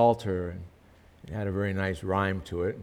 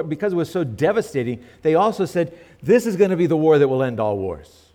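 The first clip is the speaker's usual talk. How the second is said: higher and louder, fervent preaching; preaching at full force; 6 semitones higher, 6 dB louder than usual. Acted. Preacher stirring the congregation about the war, full force. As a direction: build the sermon to a ringing peak.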